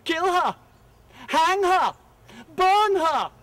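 loud high shouted demands; bay for her blood like a mob; angry mob shout. Acted. Court crowd demands Rose's death, loud high mob shout. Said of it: "they shouted".

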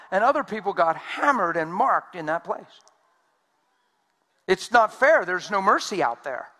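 loud, driving sermon delivery; preaching. Acted. Preacher pressing the congregation, loud and driving. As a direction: preach it hard to the room.

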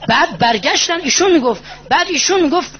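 high, impassioned preaching climax; preaching at full force. Acted. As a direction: drive the story up to its peak. Impassioned sermon continues.

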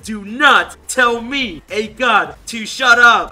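loud, slow, indignant read-out; mock a furious player, slowly and loudly; mad. Reading out the offended god's message, mock anger. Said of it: "He gets really mad".

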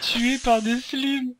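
high, slow, giggling deadpan; drawn out between laughs; laughing. Real, not acted. Mock epitaph after dying.

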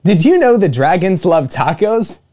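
ordinary delivery

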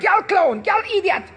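high mock jeering voice; a high hammed-up Jamaican troll jeer; mocking online critics. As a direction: sneer like mocking commenters. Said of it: "They were like".

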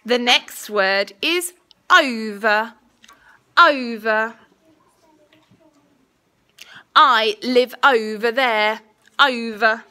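slow, clear dictation voice; school dictation. Acted. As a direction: say each word carefully for spelling. Teacher dictating a spelling test.

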